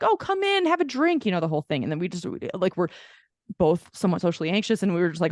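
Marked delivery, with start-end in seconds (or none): 0.0-1.3 gushing hostess invite. Retold or imitated. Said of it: "been like".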